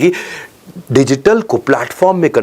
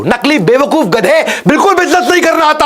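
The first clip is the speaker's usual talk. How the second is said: angry scolding; 10 semitones higher than usual. Real, not acted. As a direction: lash out at fake businessmen. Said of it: "आज कठोर बोलूंगा मैं, गुस्से में हूं". (गुस्से में डांटते हुए, ऊंची आवाज़ में)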